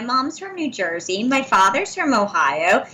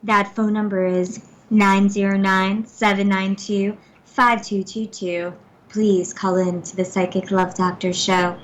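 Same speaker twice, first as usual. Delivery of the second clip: breathy, soft voice; 4 semitones lower than usual. Pretend. Co-host giving out the show's phone number, breathy and soft. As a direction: purr the number out low and unhurried. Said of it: "with a voice like that?; ever so breathy and soft voice".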